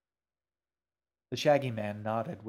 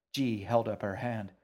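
ordinary delivery, nothing marked

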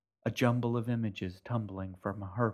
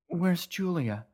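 desperate; 9 semitones higher, 4 dB louder, slower than usual. Acted. Mother desperate.

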